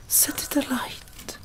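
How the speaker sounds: ordinary delivery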